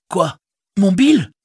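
high loud joyful cry; overjoyed at hearing of his pony; Sam crying out. Acted. Sam hearing his pony Bill is alive, loud happy cry. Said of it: "s'écria Sam".

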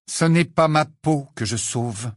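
usual speaking voice

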